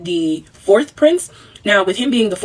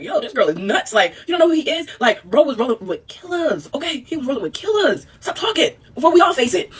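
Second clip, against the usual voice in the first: nervous townspeople; 7 semitones higher than usual. Retold or imitated. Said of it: "townspeople are like".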